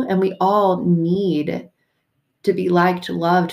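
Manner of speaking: ordinary delivery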